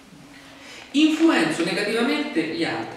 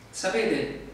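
usual speaking voice

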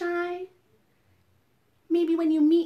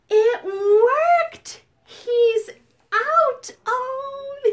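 high, loud, delighted cheer; thrilled surprise; 8 semitones higher, 6 dB louder, much slower than usual. Pretend. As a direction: celebrate it working, high and loud. Delighted that Crunch came out.

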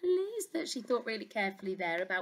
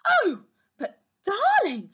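gasping queen; 11 semitones higher, 7 dB louder than usual. Acted. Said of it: "gasped the queen".

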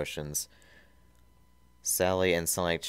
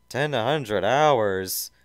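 whiny question; 6 semitones higher, 8 dB louder, much slower than usual. Acted. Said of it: "Snively asked".

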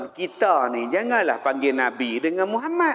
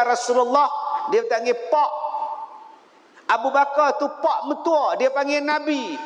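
fiery preaching; 5 semitones higher than usual. Acted. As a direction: proclaim Ya Rasulullah with fervour. Preaching climax. (ceramah lantang dan bersemangat)

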